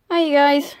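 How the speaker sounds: bright, loud greeting; excited. Real, not acted. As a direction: greet them high and loud. Cheery greeting on resuming.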